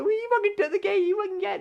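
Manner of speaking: very high, fast, loud squabbling; mock quarrel. Friends blaming the guy for the key, mock angry screech. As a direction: hurl blame in a squawky put-on voice. Retold row over the lost key.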